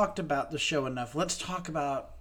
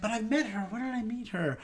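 higher-pitched, bewildered self-quote; puzzled recollection; 6 semitones higher than usual. Retold or imitated. Host replaying the puzzle over a familiar name, puzzled. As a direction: wonder aloud, replaying your own confusion. Retells own puzzled reaction.

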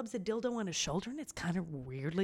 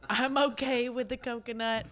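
ordinary talk

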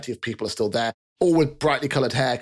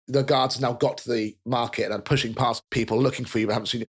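ordinary talk, nothing marked

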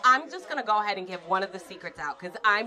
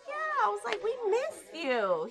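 fake sweet welcome; 13 semitones higher, slower than usual. Fake warm welcome retold.

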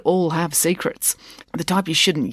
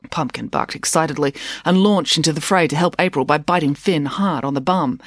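usual speaking voice

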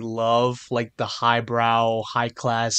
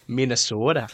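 mock Minnesota accent with a long O; 3 dB quieter than usual. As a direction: tease the Minnesota vowels. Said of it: "sound like you're from Minnesota".

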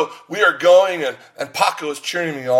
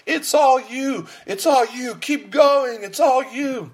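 much higher, excited spotter cheer; 10 semitones higher, slower than usual. Retold or imitated. Friend still cheering at the bench, high excited voice. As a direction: keep cheering, unaware he let go. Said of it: "still cheering me on".

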